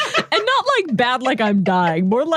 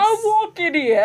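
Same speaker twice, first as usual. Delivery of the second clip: bad New York accent; 9 semitones higher than usual. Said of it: "bad New York accent".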